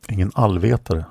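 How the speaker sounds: ordinary delivery, nothing marked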